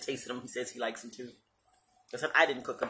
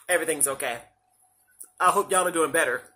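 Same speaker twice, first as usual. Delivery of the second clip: normal talking voice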